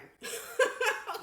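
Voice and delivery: breathy high laugh that bursts out; high burst of laughter. Real, not acted. Laughing to viewers about the tiny instructions, breathy high laugh. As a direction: laugh at how tiny the print is.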